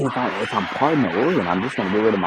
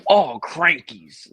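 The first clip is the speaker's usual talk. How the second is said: drawn-out, higher Australian exclamation; mock Australian accent; 6 semitones higher, much slower than usual. Shown as a demonstration. Contestant showing how Australian people say it, mock Australian accent. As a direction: stretch it out in a hammed-up Australian accent. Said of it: "how do they do it?".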